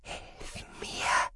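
bare whisper, no voice; faint, helpless whisper; soundless mouthing. Acted. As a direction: barely breathe the plea. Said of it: "formten ihre Lippen die lautlosen Worte".

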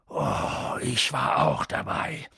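slow, low husky growl; indignant giant dropping to a growl; menacing. A narrator's reading. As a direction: rumble it with threat. Hagrid menacing, low rasp.

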